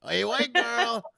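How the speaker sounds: put-on high, slow drawn-out Mexican accent; mock Mexican greeting. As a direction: stretch it out imitating her.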